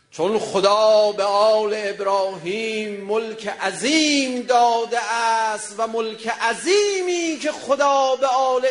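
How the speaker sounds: slow, loud, high intoning of scripture; slow chanted sermon intonation; solemn recitation. Acted. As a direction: draw each word out like a recited verse. Slow chanted Quranic-style recitation.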